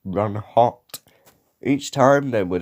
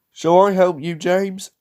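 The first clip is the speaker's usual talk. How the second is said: raised, higher-pitched offer; calling out; 9 semitones higher, 5 dB louder than usual. A narrator's reading. Edward offering James help, calling out. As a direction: call it across helpfully. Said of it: "called Edward".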